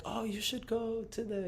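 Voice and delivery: high-pitched voice. Retold or imitated. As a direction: jump way up into a thin high voice to imitate him. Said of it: "he had a really, like, high-pitched voice".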